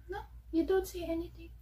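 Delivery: high, quick, softened coo to a dog; pet talk. Real, not acted. Talking to her dog.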